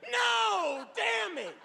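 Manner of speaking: high, drawn-out shout of dismay; anguished mock cry. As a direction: cry out in hammed-up agony. Acted cry after being shot.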